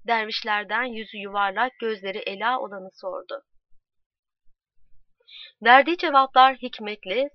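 usual speaking voice

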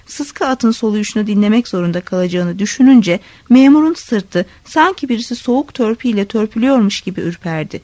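plain reading voice

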